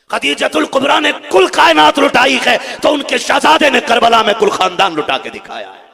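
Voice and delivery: high, loud, slow declamation; full-force preaching. Acted. Preacher praising a lineage of sacrifice, slow impassioned shouting. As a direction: proclaim it with drawn-out force.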